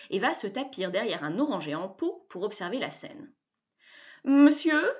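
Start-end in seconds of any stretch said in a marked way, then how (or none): 4.2-4.9 higher, stiff butler voice; haughty butler retort. A narrator's reading. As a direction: answer back like a prim butler. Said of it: "dit le majordome".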